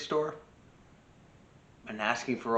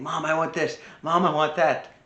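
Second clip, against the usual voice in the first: higher-pitched imitation of a demanding kid; pestering child; 5 semitones higher, 4 dB louder than usual. Child nagging mom in the supermarket, imitated pestering. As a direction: lightly mimic a child begging for things.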